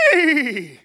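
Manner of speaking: high, loud horse neigh; horse whinny. Retold or imitated. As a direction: let out your best horse whinny. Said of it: "in my best, like, hoarse voice".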